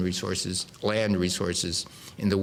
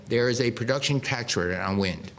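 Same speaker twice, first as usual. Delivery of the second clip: ordinary talk, nothing marked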